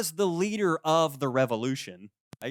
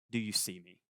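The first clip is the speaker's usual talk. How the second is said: quiet plea; 6 semitones lower, 9 dB quieter than usual. Retold or imitated. Voicing the unspoken plea.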